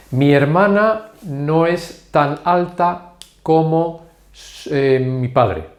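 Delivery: slow, word by word, with gaps; slow model sentence. Shown as a demonstration. Teacher gives Spanish learners an example sentence, slow and measured. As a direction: spell out the example slowly for learners.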